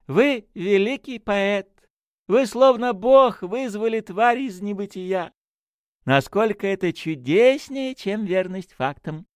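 high-pitched, slow, ecstatic praise; rapturous. A narrator's reading. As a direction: marvel aloud with soaring enthusiasm. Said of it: "восторженно вскричал он". (восторженно, высоким голосом)

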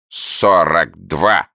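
very slow, strained croak; croaking newt. A narrator's reading. Newt answering the sum, slow strained croak. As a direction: force the answer out like a frog. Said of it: "с усилием проквакала Саламандра".

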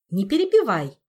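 loud scolding command; sharp shout. Acted. Queen silencing an interruption, loud and sharp. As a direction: cut them off loudly. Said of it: "прикрикнула королева".

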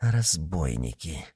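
slow, low, hushed word; muted whisper. A narrator's reading. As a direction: say it under the breath, slowly. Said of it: "прошептал он".

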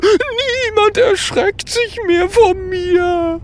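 high, slow wailing sobs; pitiful weeping. Acted. Ghost laments to the friends, wailing pitifully. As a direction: bawl it like a wretched little ghost. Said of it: "fängt es jämmerlich an zu weinen".

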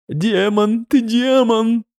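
high, slow frantic yell; raving madman. Acted. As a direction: scream it like a lunatic. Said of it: "орал сумасшедший". (вопль безумца)

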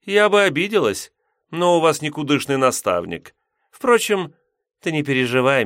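high, loud, mocking succubus; haughty demoness. A narrator's reading. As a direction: belittle the teacher, amused. Succubus continues.

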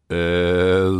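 long, loud stalling "Э-э-э"; drawn-out objecting drone; hesitant mooing hum. A narrator's reading. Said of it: "замычал зодчий". (протяжное недовольное мычание)

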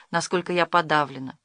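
plain reading voice

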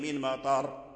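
normal talking voice

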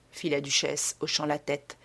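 plain reading voice

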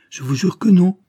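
high, loud, urgent assurance; emphatic swearing. A narrator's reading. Lawyer swearing to the daughter her father lives, emphatic.

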